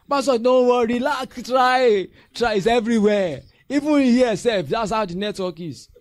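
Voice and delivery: hammed-up loud reassuring member; mock reassurance. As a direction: drag out relax and try. Member reassuring pastor, mocking.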